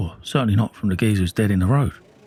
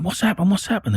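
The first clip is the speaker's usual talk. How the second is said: retold anxious questions; 10 semitones higher than usual. Neighbours anxiously asking him.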